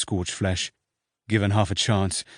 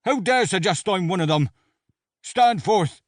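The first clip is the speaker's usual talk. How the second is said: high, loud commander's challenge; yelling; 10 semitones higher, 3 dB louder than usual. A narrator's reading. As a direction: bellow the order at the ranks. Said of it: "Slaughter yelled".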